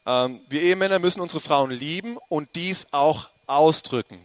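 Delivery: ordinary delivery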